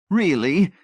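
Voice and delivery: high, loud, merry gasp; gasping with merriment. A narrator's reading. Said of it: "she gasped, heaving with merriment".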